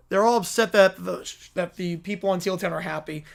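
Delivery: ordinary talk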